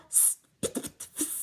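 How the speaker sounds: unvoiced spitting hisses; spitting cats. A narrator's reading. Reader hissing the cats' spit sounds. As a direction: spit the sounds like an angry cat. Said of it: "funny cat sounds".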